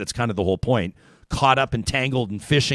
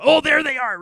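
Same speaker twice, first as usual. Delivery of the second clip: excited sighting; 5 dB louder than usual. Retold or imitated. Retelling the whale sighting moment.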